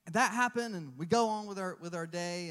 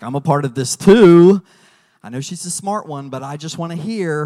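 forceful, much louder insisting; loud insistence; 3 semitones lower, 16 dB louder than usual. Retold or imitated. Husband insisting loudly to the doctor, forceful. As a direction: push in loudly to be heard.

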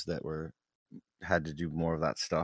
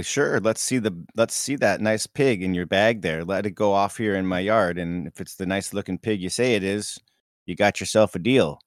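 put-on Minnesotan accent; 2 semitones higher, 6 dB louder than usual. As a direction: haggle with the neighbor in a thick Minnesota accent. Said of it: "You got that Minnesotan accent down really good".

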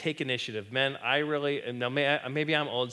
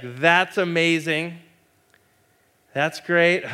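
loud mock delight; 4 semitones higher, 6 dB louder, slower than usual. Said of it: "it's like".

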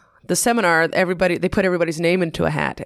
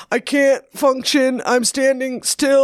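self-mocking; 6 semitones higher, slower than usual. Mocking her frozen past self.

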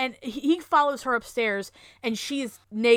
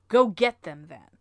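lower, louder imitated order; retold command; 4 semitones lower, 7 dB louder than usual. Wife sends him for shoes, retold command.

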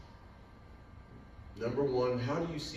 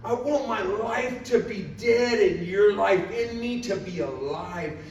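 loud, high, slow impassioned cry; fervent plea to God; 10 semitones higher, 9 dB louder, slower than usual. Retold or imitated. Worshiper offering his life to God, slow fervent cry. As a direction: call out each phrase slowly, easing at the end.

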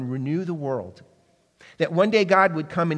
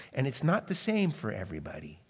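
whisper; 4 semitones lower, 7 dB quieter than usual. Real, not acted. Preacher drops to a hush.

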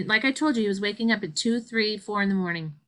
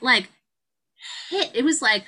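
ordinary talk

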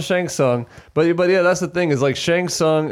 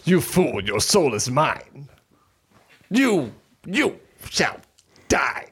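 menacing villain voice; slower than usual. Retold or imitated. Shang Tsung line impression.

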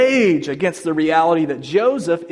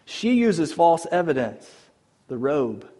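normal talking voice

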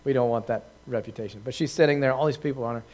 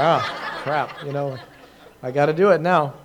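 laughing through a mock groan of defeat; rueful laugh, giving in; laughing resignation; 7 dB louder, slower than usual. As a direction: chuckle like you got caught out. Caught out, must wash feet.

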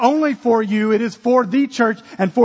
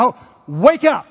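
a loud, raised wake-up call; gentle command; 4 dB louder than usual. Retold or imitated. Jesus waking the dead little girl, raised command. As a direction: raise your voice to rouse her.

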